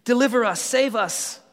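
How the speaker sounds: high, loud cry of hosanna; crowd shout. Retold or imitated. Preacher voicing the crowd begging for rescue, loud shout. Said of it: "they shout".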